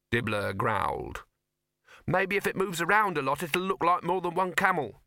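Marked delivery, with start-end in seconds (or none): 2.0-5.0 high-voiced hopeful youth; optimistic. A narrator's reading. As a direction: offer it as a hopeful suggestion, pitched up. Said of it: "said the youth optimistically".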